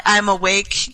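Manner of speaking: usual speaking voice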